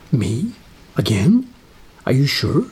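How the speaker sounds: mildly incredulous questions, each swooping up; a touch of disbelief; surprised. Retold or imitated. Asked back by producers to host, mildly surprised. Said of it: "I was surprised".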